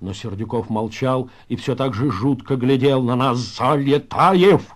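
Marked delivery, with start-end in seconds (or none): 3.4-4.6 drawn-out, slow near shout of a name. Acted. Pinya suddenly rebukes Залетаев, nearly shouting. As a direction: call him sharply to order, stretched out. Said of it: "чуть ли не закричал вдруг Пиня".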